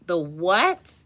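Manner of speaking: puzzled query with a sharp rise; incredulous rising double-take; baffled question. Retold or imitated. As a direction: let the last word shoot up in disbelief. Acted baffled reaction, rising.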